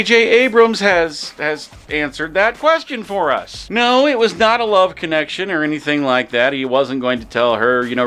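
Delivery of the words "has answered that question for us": usual speaking voice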